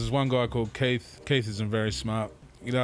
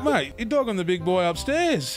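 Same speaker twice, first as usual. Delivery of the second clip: mock reproach; 7 semitones higher than usual. Retold scolding of Keith.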